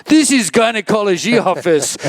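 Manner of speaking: high-pitched voice of the lady doctor; doctor's blunt reply. Retold or imitated. Lady doctor tells the American he's in a gynecology office, high and matter-of-fact. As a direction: deliver it like a stern foreign doctor setting him straight. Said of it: "She goes".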